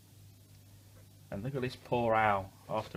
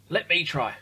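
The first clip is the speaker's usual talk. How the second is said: higher, louder eager whoop; 7 semitones higher, 6 dB louder than usual. A narrator's reading. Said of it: "whooped Douglas".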